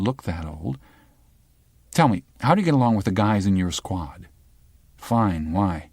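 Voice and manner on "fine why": ordinary delivery, nothing marked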